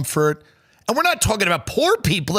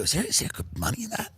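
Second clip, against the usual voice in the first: curious rich guest; 5 semitones higher, 9 dB quieter, faster than usual. Retold or imitated. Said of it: "And then they go".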